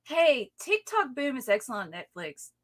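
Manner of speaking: ordinary delivery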